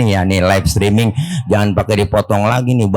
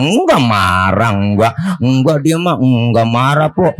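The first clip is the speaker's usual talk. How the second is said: ordinary delivery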